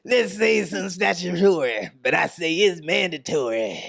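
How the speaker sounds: slow, drawn-out mock rock singer delivery; [name] impression. Friend continues reading the lyrics, mock [name] voice. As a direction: drag the lyric out like a hammy rocker. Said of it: "I've been doing it in [name] voice".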